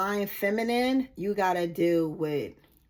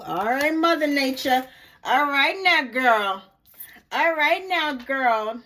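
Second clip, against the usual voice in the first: loud, high hype calls; excited hype; 4 semitones higher, 5 dB louder, slower than usual. Real, not acted. Psychic cheering on Mother Nature, loud and hyped. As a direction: cheer the energy on.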